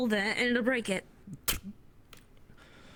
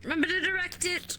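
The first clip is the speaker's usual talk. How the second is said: loud high call over the work; 8 semitones higher, 5 dB louder than usual. Real, not acted. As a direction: shout the reminder mid-pull. Shouting over the hauling effort.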